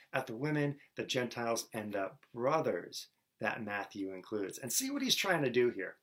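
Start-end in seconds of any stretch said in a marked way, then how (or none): none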